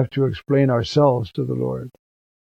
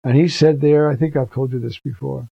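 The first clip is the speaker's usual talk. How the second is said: ordinary delivery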